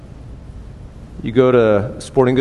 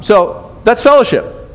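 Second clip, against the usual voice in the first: ironic punchline; 10 semitones higher, 4 dB louder than usual. Acted. Ironic punchline about grandkids.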